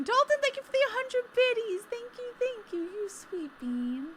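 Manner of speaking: high cutesy thanks. Real, not acted.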